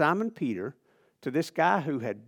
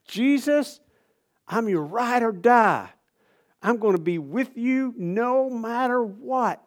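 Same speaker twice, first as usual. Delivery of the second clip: brash promise; 6 semitones higher, 5 dB louder, slower than usual. Acted. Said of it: "he promised with all his heart".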